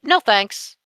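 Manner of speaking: ordinary delivery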